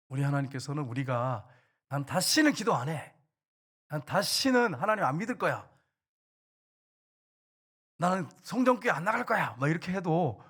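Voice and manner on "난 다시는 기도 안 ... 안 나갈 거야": loud, high mock sulky refusal; throwing a tantrum. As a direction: act out a stubborn outburst, voice pushed up. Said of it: "여러분이 때를 써도". (떼쓰듯 높고 크게)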